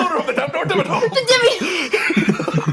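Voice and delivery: high, slow tycoon line broken by laughter; laughing Doug Dimmadome. Retold or imitated. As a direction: giggle through the big showy title. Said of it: "I'm Doug Demdor".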